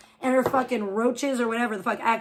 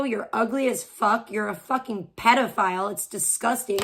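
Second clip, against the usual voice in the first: ordinary talk, nothing marked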